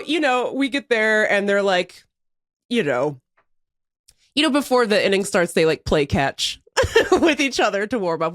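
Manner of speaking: ordinary talk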